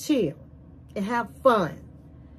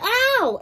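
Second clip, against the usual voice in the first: a retold yelp, sharply higher; imitating a pained yelp, short and high; mock ow of pain; 10 semitones higher than usual. He yelps when head touched.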